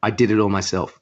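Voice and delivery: ordinary delivery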